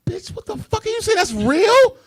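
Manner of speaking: very high, loud scandalized shriek; high-pitched pearl-clutching gasp; shocked disbelief. Retold or imitated.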